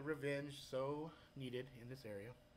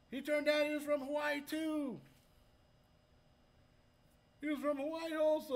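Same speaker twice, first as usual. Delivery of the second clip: excited; 12 semitones higher, 7 dB louder than usual. Real, not acted. Excited about a fellow islander.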